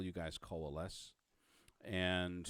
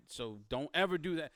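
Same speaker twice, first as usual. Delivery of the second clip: raised, emphatic cop's warning; stern warning; 7 semitones higher, 6 dB louder than usual. Acted. Cop warning an informant never to do that, stern and emphatic. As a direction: warn them off, louder and higher.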